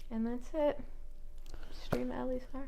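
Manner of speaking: soft whispered plug. Real, not acted. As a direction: whisper it like a secret.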